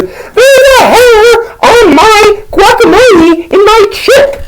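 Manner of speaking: very high, slow mock wail; hammed-up falsetto horror; horrified friend. Homie finds hair in guacamole, mock horrified wail.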